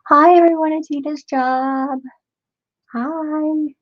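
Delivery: high, drawn-out sing-song hello; high-pitched, long-held cheery hi; playful group greeting. Pretend. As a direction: greet a whole office in a silly high voice. Greeting co-workers through a viewer's stream.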